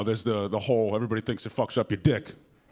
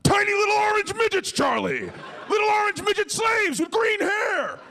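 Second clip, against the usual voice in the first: put-on much higher, frantic old man yelling; crazed grandpa shout. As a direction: bellow it like an unhinged old grandpa. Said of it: "the grandpa was like".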